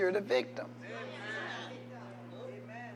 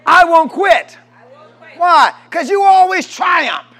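much higher and louder, building to a shout; preaching at full force; 10 semitones higher, 13 dB louder than usual. Real, not acted. Preacher declaring victory to the crowd, shouting. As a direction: whip the congregation up to a roar.

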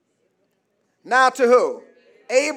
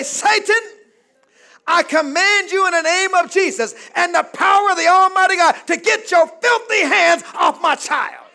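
loud high rebuke of Satan; commanding rebuke; 7 semitones higher, 7 dB louder than usual. Shown as a demonstration. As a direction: command the devil to leave. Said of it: "at their bedside and say".

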